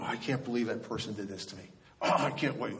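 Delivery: hushed, quick indignant mutter; imitating someone quietly fuming; seething inner grumble. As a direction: grumble low and fast, stewing to yourself. Acts out seething thoughts at night.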